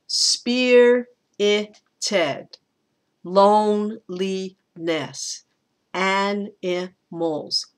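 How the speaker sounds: "Spirited", "loneliness", "animals" broken slowly into three syllables, first and last stressed; syllable by syllable, punching the first of "Spirited", "loneliness", "animals"; stress, unstressed, stressed. Shown as a demonstration. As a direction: beat out SPI-rit-TED, LONE-li-NESS, AN-i-MALS. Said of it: "they go stress, unstressed, stressed".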